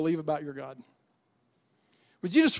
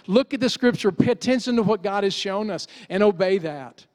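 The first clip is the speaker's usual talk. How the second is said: preaching at full force; 2 semitones higher, 5 dB louder than usual. Real, not acted. Pastor urging the church to hope in God, forceful preaching. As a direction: hammer each point home to the congregation.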